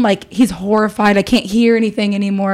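usual speaking voice

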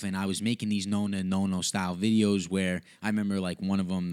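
ordinary delivery

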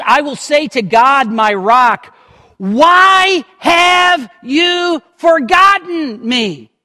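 dramatic lament; anguished outcry. Acted. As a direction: cry out to God in despair. Reading the psalmist's lament.

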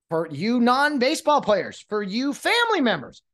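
ordinary delivery

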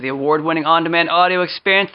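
normal talking voice

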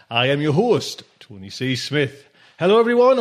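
ordinary delivery

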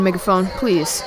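normal talking voice

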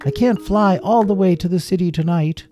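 high, loud sustained calling; projected flyer's call to passengers. A narrator's reading. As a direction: shout directions over rushing wind and waves. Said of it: "he had to raise his voice".

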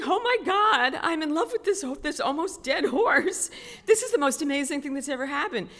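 high, excited inner exclamation; gushing amazement. Retold or imitated. Rider falling for an almost dead horse, acted excitement. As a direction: gush as if newly smitten. Ecstatic thought about the horse.

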